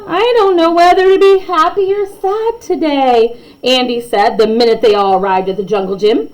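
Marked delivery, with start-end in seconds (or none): 0.1-3.4 lightly voiced unsure child, higher; kid voice. Andy at the jungle gym, lightly voiced child. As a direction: play a torn schoolkid. Child character quoted in story.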